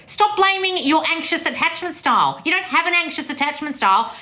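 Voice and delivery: high, emphatic coaching tirade; insistent berating. Real, not acted. Berating listeners about attachment style.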